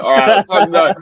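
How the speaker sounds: rally yourself before the race; hyped shout. Retold or imitated. Hyping the team before the race, loud and high.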